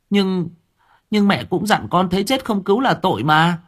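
put-on a child protesting his mother's scolding; lightly voiced arguing boy. A narrator's reading.

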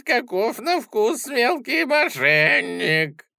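high, creaky, slow monster voice; high creaky troll voice. Acted. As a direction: croon it like a hungry troll luring prey. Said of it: "высокий и скрипучий".

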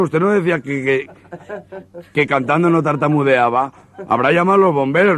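high put-on stammering voice, stuck on que; stutterer's voice. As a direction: trip over que again and again. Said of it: "el tartamudo".

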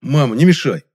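loud, annoyed brush-off; indignant. Acted. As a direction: wave mother off, indignant. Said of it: "возмутился я".

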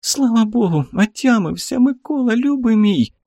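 high, tender woman's relief. A narrator's reading. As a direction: thank God he's awake, tenderly. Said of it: "Голос близкий, родной".

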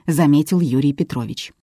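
plain reading voice

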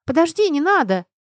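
high, loud exclamation; exclaiming. A narrator's reading. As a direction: cry out to stop her. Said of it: "воскликнула Альбина".

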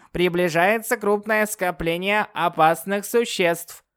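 high, slow synthetic warning; old speech synthesizer. Acted. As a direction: read it like a machine alarm. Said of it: "синтезированный голос Чипа".

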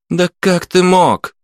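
high, stretched-out reproach; high-pitched, pressing indignation; indignant accusation. A narrator's reading. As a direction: demand it in outraged disbelief. Woman keeps pressing her accusation.

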